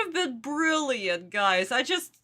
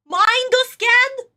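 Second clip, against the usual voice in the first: mock shout; 8 semitones higher, 6 dB louder than usual. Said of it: "Why doesn't he shout mind scan?".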